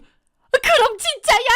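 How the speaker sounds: very high, drawn-out shriek of disbelief; shocked mother. A narrator's reading. Mother hearing her son confirm it, aghast. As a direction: cry it out high and slow, aghast.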